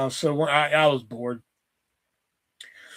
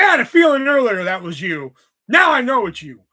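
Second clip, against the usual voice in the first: loud, higher mimicry of a girl catching on; imitated coworker; 7 semitones higher, 8 dB louder than usual. Kiosk girl catches prank caller, loud mimicked voice. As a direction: call out the prankster in a raised voice.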